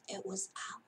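soft whisper; whispering it was Owl; whispered reveal. A narrator's reading. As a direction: let the secret out in a hush.

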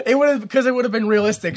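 normal talking voice

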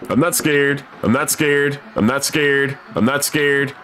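scared self-reassurance. Real, not acted. Player telling themself they aren't scared of the game, nervous high repetition. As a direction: convince yourself you're not afraid. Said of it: "I'm fucking terrified".

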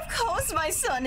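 ordinary delivery

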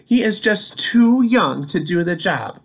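lightly voiced Peter the truck; Peter's voice. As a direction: voice Peter a bit up. Peter voiced in read story.

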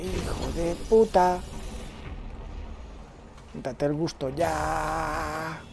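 slow mock drawl. Mock reply to himself.

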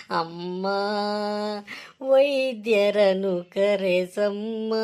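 slow, weak, pained pleading; moaning in pain.

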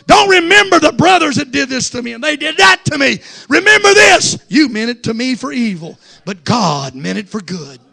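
high, loud shouted preaching; preaching at full force. Acted. Preacher shouting to the church about forgiving brothers. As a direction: thunder it at the congregation.

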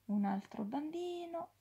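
plain reading voice

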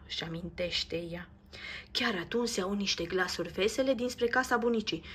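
usual speaking voice

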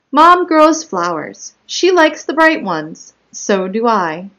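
measured, animated storybook narration; picture-book read-aloud. Acted. Child narrator telling about Mom's flowers, read as expressive story narration. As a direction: lift each sentence for young listeners, then settle.

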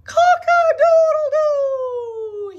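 high, slow, ringing rooster crow; proud crow. Acted. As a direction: crow it out triumphantly. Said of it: "he crowed".

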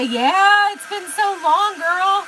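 loud, high squeal of delight; thrilled, high-pitched hello; excited greeting. Real, not acted. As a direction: greet a long-missed friend at full volume. Excited greeting to arriving viewer.

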